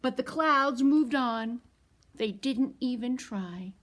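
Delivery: picture book read to toddlers; storytime reading. A narrator's reading. Reads the picture book aloud.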